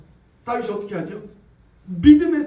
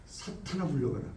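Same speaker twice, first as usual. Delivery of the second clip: whispered command to Satan; low, breathy rebuke under the breath; hushed rebuke; 6 semitones lower, 12 dB quieter than usual. Acted. (속삭이듯 사탄을 꾸짖으며)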